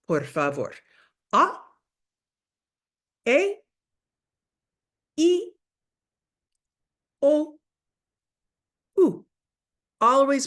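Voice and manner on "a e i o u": "A, E, I, O, U" each pure and clipped apart; teacher modelling vowels for repetition; vowel demo. Shown as a demonstration. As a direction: model each Spanish vowel "A", "E", "I", "O", "U" cleanly for students to echo. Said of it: "Let me say it, and then you say it after me".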